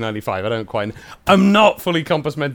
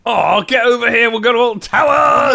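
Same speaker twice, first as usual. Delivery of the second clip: calling out; 7 semitones higher, 5 dB louder than usual. Real, not acted. Calling a friend over.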